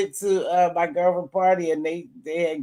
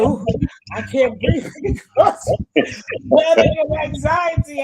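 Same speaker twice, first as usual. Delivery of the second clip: laughing quote; 8 semitones higher, 4 dB louder, slower than usual. Retold or imitated. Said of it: "And I said".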